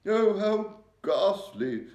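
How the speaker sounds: high, loud, slow cry of horror; horrified cry. A narrator's reading. The Queen reading about the stolen children, horrified cry. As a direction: recoil in horror at the news. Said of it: "the famous voice cried out".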